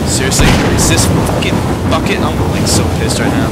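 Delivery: furious rant. Real, not acted. Gamer yelling at the game in frustration, furious shout. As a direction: vent the rage out loud.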